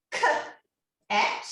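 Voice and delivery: k and at split apart as separate sounds; segmenting sounds. Shown as a demonstration. Teacher showing children onset and rhyme, sounds split apart. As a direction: break the word into onset and rhyme. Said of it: "So we have".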